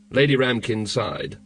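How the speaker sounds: usual speaking voice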